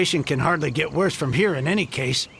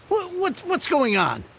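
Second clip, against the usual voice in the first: a sudden high startled "Wha-"; stunned disbelief; 10 semitones higher than usual. Acted. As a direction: caught completely off guard, stammering. Merchant stunned by the offer.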